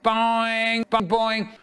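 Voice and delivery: ringing, drawn-out bell noise; bell imitation. A narrator's reading.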